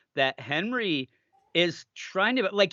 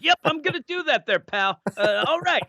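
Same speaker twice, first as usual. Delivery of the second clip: much higher, quick, cheery brush-off; nice guy Henry; 11 semitones higher than usual. Retold or imitated. Henry cheerfully humoring the creepy kid, high and fast. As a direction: humor the weird kid like a friendly neighbor. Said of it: "he's so nice".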